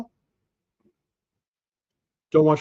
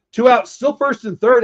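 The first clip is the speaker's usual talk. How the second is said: louder and higher play call; excited; 6 semitones higher, 5 dB louder than usual. Real, not acted. Calling the game live.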